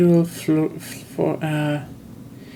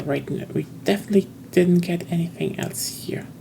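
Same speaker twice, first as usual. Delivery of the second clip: whispering; 7 dB quieter than usual. Real, not acted. Checks loot to himself.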